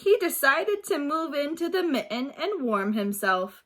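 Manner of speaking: put-on reading aloud to young children, lively; storybook reading. A narrator's reading. Teacher reading a picture book to her class, lively. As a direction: bring the story alive for the class.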